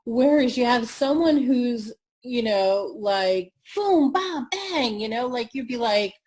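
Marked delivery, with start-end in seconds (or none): 3.8-5.0 high, loud sound-effect words; punchy high-energy burst. Shown as a demonstration. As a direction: punch each word out like a hit. Mimics a high-energy, engaging speaker.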